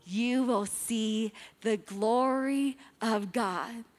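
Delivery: slow proclamation. Real, not acted. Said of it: "slow solemn quoting".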